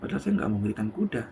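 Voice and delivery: a father's private puzzled thought, half-whispered; softly whispered inner aside; inner thought, hushed. Acted. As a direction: think it aloud under your breath. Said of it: "dalam hatinya si bapak".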